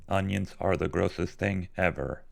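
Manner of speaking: evenly pitched, measured robot monotone. Shown as a demonstration. Streamer obliging a viewer's request, in robot voice. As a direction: say it like a robot, word by word. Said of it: "say in the robot voice".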